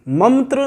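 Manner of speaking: "मंत्र" said high as mam-tra; mispronunciation with m. Shown as a demonstration. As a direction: close the lips for an m in "मंत्र". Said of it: "मंत्र नहीं है". ("मंत्र" में न की जगह म)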